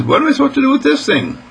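high, quick cat voice; puzzled cat. Acted. Cat facing a new little house, high-pitched and baffled. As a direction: squeak it out, fast and baffled.

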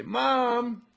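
high, loud drawn-out call; acted child's holler, stretched slowly; calling for Mom. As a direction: call through the house like a kid. Demonstrating boy calling mother.

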